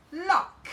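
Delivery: Lock said loud and high as the blended word; sounded-out word. Shown as a demonstration. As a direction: announce Lock clearly after blending. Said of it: "Well done, Missus Brownie".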